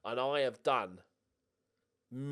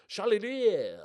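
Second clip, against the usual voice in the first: a louder, higher put-on French accent on the name; 8 semitones higher, 6 dB louder, slower than usual. Manager announcing the big-money signing's name, in a mock French accent. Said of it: "don't know why I've gone with the accent".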